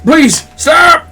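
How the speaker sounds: high, loud begging shout; desperate pleading. Acted. Cornered man begs the attackers to stop, desperate shout. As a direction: plead frantically for your life.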